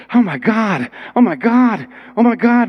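rising astonished exclamations; building amazement. Retold or imitated. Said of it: "He goes".

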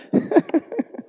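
a squeaky helpless laugh, no voice; high breathy laugh. Real, not acted. Preacher to congregation about a hard verse, high breathy laugh.

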